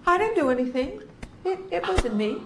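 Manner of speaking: high, wide-eyed protest of innocence; innocent kid. Retold or imitated. Mocking how foster kids deny everything, high innocent whine. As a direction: play the kid who swears it wasn't him.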